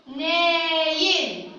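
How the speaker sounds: ناين slowly as a long "naa" then "yin"; قراءة تعليمية. Shown as a demonstration. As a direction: stretch the first vowel of ناين. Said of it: "أو التنوين بالكسر". (نطق «نايٍ» ببطء مع مدّ الألف)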